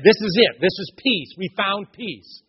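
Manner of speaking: loud, high, eager declaration; seekers claiming peace. Retold or imitated. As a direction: announce it like they've found the answer. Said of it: "And they said".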